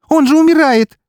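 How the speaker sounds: очень высоко и очень громко; ори в отчаянии; истошный крик. Acted. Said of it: "заорала она". (Ксения орёт, теряя самообладание)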